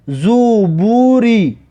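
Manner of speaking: slow, joined syllables with long vowels; drawn-out model reading. Shown as a demonstration. Teacher models a word for learners, slow and drawn out. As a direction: stretch "زوبوری" in one slow run.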